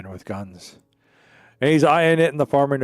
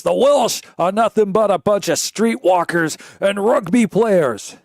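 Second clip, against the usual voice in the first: higher, louder barroom declaration; loud pub boast; 8 semitones higher, 7 dB louder, slower than usual. Acted. Pub drinker loudly insulting the Welsh, raised voice. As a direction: announce it like a pub loudmouth.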